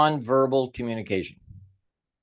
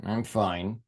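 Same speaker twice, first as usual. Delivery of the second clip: a low mumbled fine that means the opposite; low, soft and grudging; 5 semitones lower, 6 dB quieter than usual. Shown as a demonstration. Teacher showing students a sulky reply. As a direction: drop the pitch and say it under your breath, unconvinced. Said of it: "same words".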